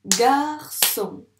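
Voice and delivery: "Garçon" loud, split as gar-çon; syllable by syllable. Shown as a demonstration. Teacher tapping syllables with a pupil, "Garçon" split loudly. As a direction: clap out "Garçon" firmly, gar, then çon. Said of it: "On tape les syllabes ensemble".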